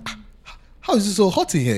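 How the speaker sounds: mock a Nigerian fresh back from London; put-on British accent, laughing; fake British returnee accent. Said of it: "come back with an accent".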